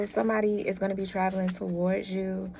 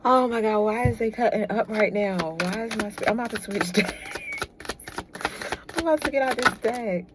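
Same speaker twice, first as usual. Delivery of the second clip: louder, higher excited outburst; 3 semitones higher, 3 dB louder than usual. Real, not acted. Tarot reader exclaiming at cheeky cards.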